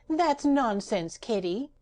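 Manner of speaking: high, slow, breezy; cheerful retort. Acted. Patchwork Girl dismissing Ojo's gloom, high and cheerful. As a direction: brush it off cheerfully. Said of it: "retorted the Patchwork Girl cheerfully".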